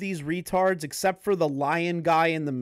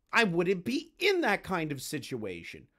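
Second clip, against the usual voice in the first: ordinary delivery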